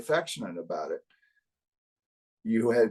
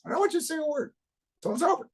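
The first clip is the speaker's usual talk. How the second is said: much higher stern New Jersey order; New Jersey accent; much faster than usual. Retold or imitated. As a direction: tell him to stay silent, New Jersey style. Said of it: "he's got a New Jersey accent".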